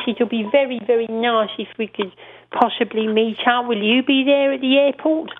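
high, prim, overly polite phone voice; Robin Cooper voice. Retold or imitated. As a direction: play a fussy, genteel caller asking to meet. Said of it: "he sort of speaks a bit like this".

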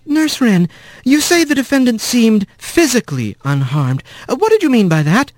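gentle careful attorney; young mouse lawyer. A narrator's reading. Kylie questions.

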